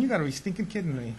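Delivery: high, put-upon whine with a laugh; mock self-pitying grumble; whining inner voice.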